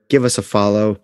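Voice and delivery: normal talking voice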